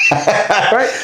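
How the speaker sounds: ordinary delivery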